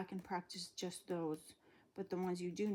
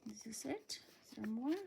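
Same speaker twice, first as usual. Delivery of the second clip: whispered question to herself; whispering; 3 semitones higher than usual. Real, not acted. Whispered aside checking the book.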